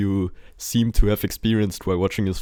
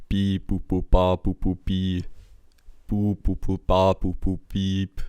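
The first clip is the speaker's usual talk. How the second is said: robot beeps. Pretend. Mimicking electronic music sounds.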